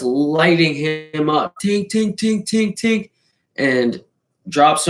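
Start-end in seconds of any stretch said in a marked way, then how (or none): 1.6-3.1 high tink noises for each hit; punch sound effects. Retold or imitated. Retelling friend lighting him up, punch sound effects. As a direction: mimic repeated punches, rising.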